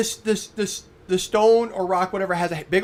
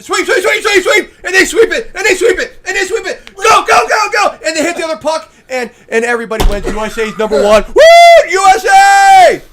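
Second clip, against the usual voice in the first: high shouted cheering commentary; excited shouting; 12 semitones higher, 13 dB louder than usual. Pretend. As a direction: yell it like the winning shot. Mock curling play-by-play, cheering.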